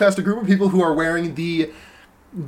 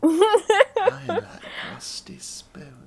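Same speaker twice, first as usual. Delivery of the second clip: high, then a soft whispered creepy breath; creepy whispered cartoon voice; eerie whisper, dragged out; Salad Fingers voice; 4 dB quieter, much slower than usual. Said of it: "Tell me all about your fucking rusty spoons".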